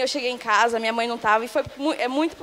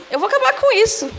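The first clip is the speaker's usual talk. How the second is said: higher-pitched retelling of desperate resolve; retold desperation; 10 semitones higher, 4 dB louder than usual. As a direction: relive the breaking point, voice jumping up. (desespero relembrado, voz mais aguda)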